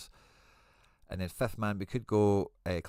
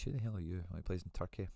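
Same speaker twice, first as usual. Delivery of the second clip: ordinary talk, nothing marked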